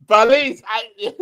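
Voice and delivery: a Dominican accent played for laughs. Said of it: "I start talking like that".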